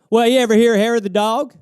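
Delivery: pushy buddy. Retold or imitated. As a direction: push the advice on him. Acted drinking buddy dialogue.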